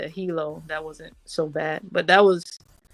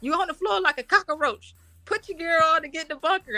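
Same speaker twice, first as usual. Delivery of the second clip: scolding sergeant; 10 semitones higher than usual. Retold or imitated. Sergeant scolding lost lieutenant.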